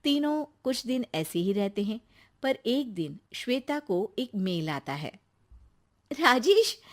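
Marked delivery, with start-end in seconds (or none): 0.0-5.2 low, quiet storyteller voice; story narration. A narrator's reading. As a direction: ease into the next scene. Narrator describes scene.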